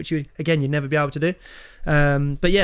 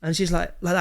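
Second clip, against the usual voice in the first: ordinary talk, nothing marked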